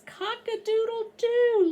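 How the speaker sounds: high, loud cock-a-doodle-doo; rooster crow. Shown as a demonstration. Librarian showing children how the rooster goes, high loud crow. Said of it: "How does the rooster go?".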